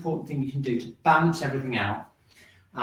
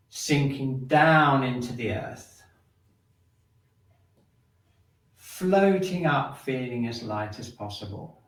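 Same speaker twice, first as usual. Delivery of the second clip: slow guided movement cues; 2 semitones lower, slower than usual. Acted. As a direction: stretch each cue like a slow tide.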